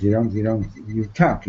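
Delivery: ordinary delivery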